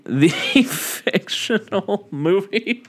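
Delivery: slow, high repeat through laughter; high-pitched, drawn-out laughing disbelief. Real, not acted. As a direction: echo it incredulously while cracking up. Laughing at an absurd movie theory.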